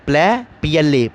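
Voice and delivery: "प्ले" set apart, then spelt letter by letter; slow, careful spelling of the first syllable; syllable split. Shown as a demonstration. Teacher spelling out the syllable for students, slowly. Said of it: "प्लैनेट में दो sound हैं".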